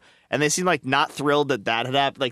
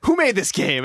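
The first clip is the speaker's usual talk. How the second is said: imitated yell at a crowd, higher; mock-holler out to the audience; 6 semitones higher, 4 dB louder than usual. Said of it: "he started yelling in the audience".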